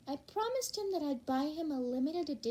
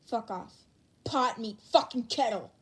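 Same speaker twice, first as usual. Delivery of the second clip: ordinary talk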